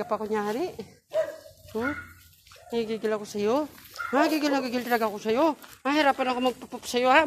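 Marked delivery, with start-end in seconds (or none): 2.7-7.2 playful exasperation. Real, not acted. Mock scolding of the puppy.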